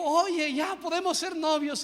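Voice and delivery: high, bright eager offer; eager excited girl. Retold or imitated. Girl rushing to the newly baptized man, high eager voice. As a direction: lift the voice up, eager to date him now.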